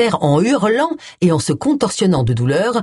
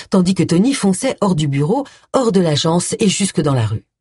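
ordinary delivery, nothing marked

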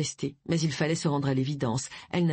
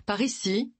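called out from another room, raised and higher; a voice calling from the left; 5 semitones higher than usual. A narrator's reading. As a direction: call them through to the room. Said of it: "l'entendent lancer".

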